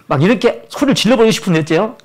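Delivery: ordinary delivery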